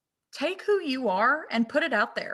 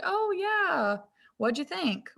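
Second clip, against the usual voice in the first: friendly professional; 8 semitones higher, much slower than usual. Pretend. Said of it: "they'd be like".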